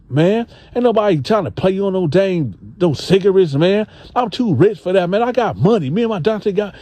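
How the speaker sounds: high, fast brushing-off boast; Gene voiced boastful. Gene bragging to the dude about his money, high and dismissive. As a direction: play Gene waving him off, pitched high. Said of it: "He like".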